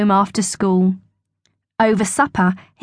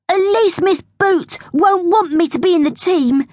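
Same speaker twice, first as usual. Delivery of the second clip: put-on high, quick, lively boy's voice; Bertie, hopeful schoolboy; 9 semitones higher, faster than usual. A narrator's reading. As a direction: protest quickly in a raised schoolboy voice.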